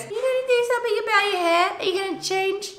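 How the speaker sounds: high fast mocking voice; imitating boyfriend. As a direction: parrot him in a high squeaky voice. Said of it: "he goes".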